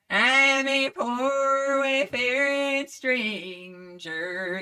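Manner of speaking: high, slow, sung country twang. Shown as a demonstration. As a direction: drawl it like a bluegrass singer. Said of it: "there's that twang, that [name] kinda thing".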